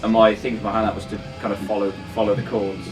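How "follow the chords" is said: ordinary talk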